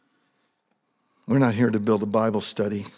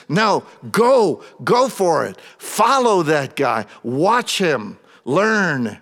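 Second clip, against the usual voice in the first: louder, higher, fervent preaching; preaching at full force; 6 semitones higher, 6 dB louder than usual. Acted. Preacher reads and retells the scene to his congregation, fervent. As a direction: preach it with rising fire to the room.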